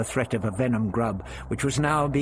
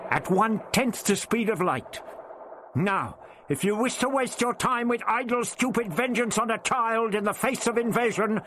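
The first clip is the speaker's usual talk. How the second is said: put-on high, firm old man insisting; old Doctor, firm; 11 semitones higher than usual. A narrator's reading. The Doctor bargains with the voice, firm. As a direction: stand your ground, lay out the facts.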